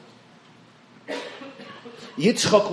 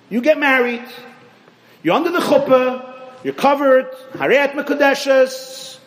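high, loud storyteller's build-up; preaching at full force; 9 semitones higher, 7 dB louder than usual. Acted. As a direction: sweep the crowd along, rising and loud. Dramatic retelling of wedding.

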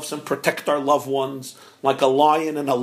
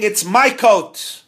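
slow, loud, higher yell of my coat; mock scream; 5 semitones higher, 6 dB louder, much slower than usual. Rabbi imitating the quarreling men, slow loud yell. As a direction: shout MY coat, slowly. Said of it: "You were screaming".

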